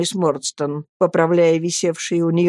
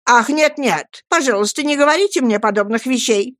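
higher-pitched, mildly annoyed protest; vexed interruption; 6 semitones higher than usual. A narrator's reading. Miss Murdstone refuses such compliments, vexed and pitched up. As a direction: cut in with vexation. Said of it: "с досадой прервала её мисс Мордстон".

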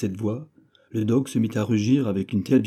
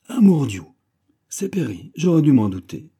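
plus haut et fort, étonné; s'exclame en reconnaissant un vieil ami; surprise joyeuse; 6 semitones higher, 6 dB louder than usual. A narrator's reading. D'Artagnan reconnaît Perry. (d'Artagnan reconnaît Perry, exclamation joyeuse et surprise)

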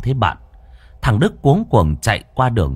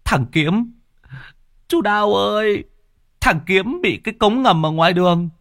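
whimpering cry; 12 semitones higher than usual. Acted. Sobbing boy tells Uncle Đào his son was sucked in. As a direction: blurt it out on the verge of tears. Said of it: "mếu máo".

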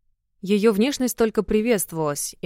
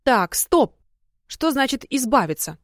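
alarmed; 5 semitones higher than usual. A narrator's reading. Alarmed at sister's plan.

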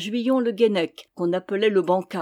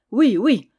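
louder, eager double yes; exclaiming; 3 semitones higher, 8 dB louder than usual. A narrator's reading. Monk jumping in to agree with the knight, exclaiming. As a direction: burst in loudly agreeing. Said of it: "s'écria Bruno".

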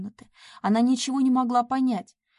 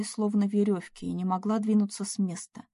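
ordinary delivery

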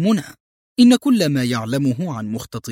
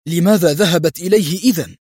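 higher, louder baffled question; astonished exclaim; 4 semitones higher, 5 dB louder than usual. A narrator's reading. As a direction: blurt it out in disbelief. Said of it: "هتف ديفيد في دهشة".

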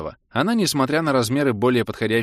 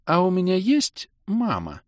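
высоко, медленно, по-детски; спроси папу как маленькая дочка; детский голосок; 11 semitones higher, slower than usual. A narrator's reading. Дочь спрашивает отца о маме. (тонкий голос ребёнка)

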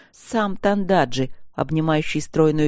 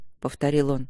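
ordinary delivery

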